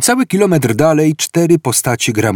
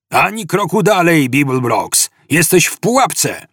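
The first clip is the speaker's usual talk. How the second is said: raised-pitch megaphone command, mildly shouted; megaphone roar; 6 semitones higher than usual. A narrator's reading. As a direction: call out a warning as if through a megaphone. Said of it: "Z ręcznego megafonu zaryczał głos". (okrzyk jak przez megafon)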